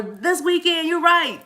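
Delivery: high, loud excited imitation; excited viewer. Viewer reporting back after the live, imitated excitedly. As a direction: voice a thrilled fan up high. Voices viewers' emails and comments.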